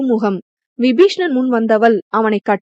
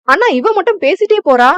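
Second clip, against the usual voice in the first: complaining sister; 8 semitones higher, 3 dB louder than usual. Acted.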